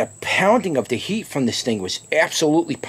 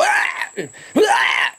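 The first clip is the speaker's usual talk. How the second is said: mock gag yell; 8 semitones higher than usual. Shown as a demonstration. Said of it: "had me on that verge to go".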